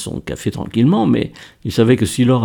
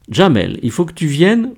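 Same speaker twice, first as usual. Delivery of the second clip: mildly excited, voice rising at the end; a lift of eager excitement; 7 semitones higher than usual. A narrator's reading. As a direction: urge him along with eager excitement. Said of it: "lui dit-elle tout excitée".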